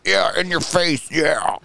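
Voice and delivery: imitating a fake fan who cheers only at the Super Bowl, slow mock gloat; gloating fair-weather fan. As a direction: ham up a bandwagon fan gloating. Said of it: "they're like".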